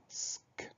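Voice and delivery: voiceless Sk, no vowel; soft hissed S closed by a bare K; isolated S-K sounds. Shown as a demonstration. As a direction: sound out just the S and K of escargot. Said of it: "on entend ces deux sons-là".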